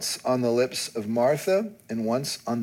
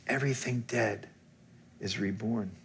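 usual speaking voice

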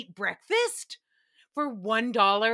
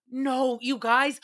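ordinary delivery

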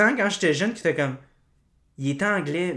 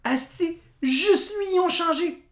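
much higher pitched disbelief; incredulous; 13 semitones higher than usual. Real, not acted. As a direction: still can't believe it. Incredulous repeat. (incrédule, voix très aiguë)

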